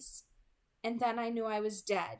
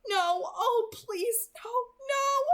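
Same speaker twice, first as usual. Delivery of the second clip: high, loud, crying pleas; sobbing plea; 10 semitones higher, 6 dB louder, slower than usual. A narrator's reading. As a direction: break down pleading in tears. Angel sobbing over Bella.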